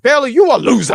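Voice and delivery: put-on high, loud, aggressive Boston-accented taunt. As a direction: sneer it like a Boston heel. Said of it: "And the way she said it".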